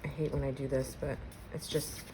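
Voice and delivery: muttered under the breath, annoyed at the setup; quiet, fed-up apology; annoyed aside. Real, not acted. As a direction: grumble softly to yourself, half apologizing. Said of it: "I'm getting a little annoyed with the setup here".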